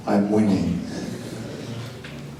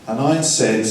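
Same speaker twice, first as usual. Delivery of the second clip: ordinary talk, nothing marked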